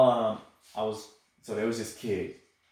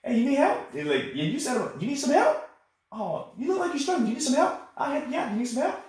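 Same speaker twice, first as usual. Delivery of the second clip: high, sing-song fake helpful offer, a bit louder; mock sweet offer; 14 semitones higher, 5 dB louder than usual. Sergeant teasing a struggling new private, high mock sweetness. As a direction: dangle help in front of him like bait, teasing. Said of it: "I've done that at least two or three times".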